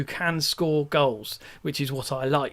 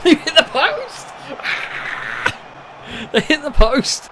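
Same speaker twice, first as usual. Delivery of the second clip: much higher, louder, laughing disbelief; excited laughing; 11 semitones higher, 5 dB louder than usual. Real, not acted. Watching the opponents hit the post, laughing disbelief. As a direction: laugh it out in shock.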